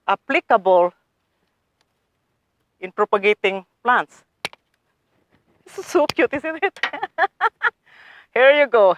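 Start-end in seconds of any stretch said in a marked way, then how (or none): 5.6-9.0 high-pitched cooing that breaks into laughter; delighted gushing. Real, not acted. Gardener showing viewers new roots, delighted gushing. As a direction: gush over it, then giggle.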